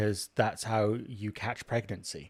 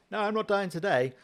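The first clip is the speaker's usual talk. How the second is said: higher, breezy defiance; mock defiant Sisyphus; 10 semitones higher, 3 dB louder than usual. Sisyphus brushing off death, higher mock defiance. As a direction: toss it off like a cheeky escapee. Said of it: "he's like".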